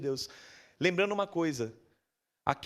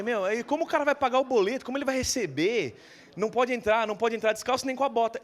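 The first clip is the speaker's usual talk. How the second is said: higher, louder pleading to the guard; exasperated protest; 7 semitones higher, 4 dB louder than usual. Retold or imitated. Arguing with the bank guard to let the man in, exasperated. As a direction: push back hard, pitched up and raised. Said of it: "Eu falei".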